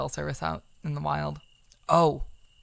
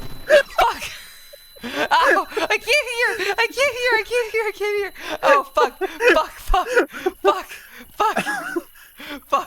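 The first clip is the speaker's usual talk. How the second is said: panicked screaming; 8 dB louder, much slower than usual. Real, not acted. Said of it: "I sound like pepper sprayed and flashbanged".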